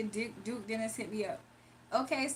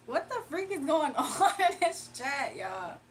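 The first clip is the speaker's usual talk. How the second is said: laughing; 3 semitones higher than usual. Real, not acted. Reading a chat message, laughing.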